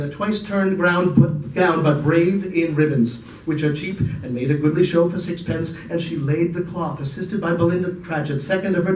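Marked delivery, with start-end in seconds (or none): none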